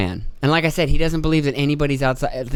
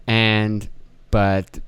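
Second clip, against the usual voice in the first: ordinary talk, nothing marked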